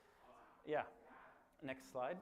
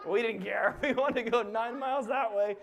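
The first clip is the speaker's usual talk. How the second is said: laughing; 9 semitones higher, 11 dB louder than usual. Real, not acted. Laughing recollection.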